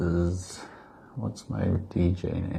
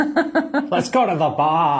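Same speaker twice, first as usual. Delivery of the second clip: high, slow laughing joke; 10 semitones higher, 6 dB louder, slower than usual. Pretend. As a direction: say it through a laugh. Joking laughing proposal.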